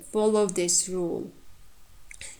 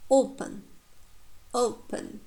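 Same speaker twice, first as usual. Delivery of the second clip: "Open" said O-pen, the pen weak; strong long O in "Open", reduced second syllable; teacher's careful "Open, open" stressing the O; first-syllable stress; 3 semitones higher than usual. Shown as a demonstration. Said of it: "first syllable takes stress".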